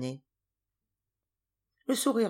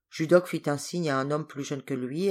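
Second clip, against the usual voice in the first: usual speaking voice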